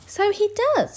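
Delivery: very high squeaky agreement; little skeleton voice. A narrator's reading. Little skeleton agrees the dog looks odd, in a high squeak. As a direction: chirp it back. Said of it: "said the little skeleton".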